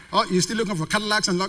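normal talking voice

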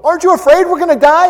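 high, loud, fast panic; frantic high cry; panicked disciples. Acted. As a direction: rush it out in alarm. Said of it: "Jesus sleeping in the boat".

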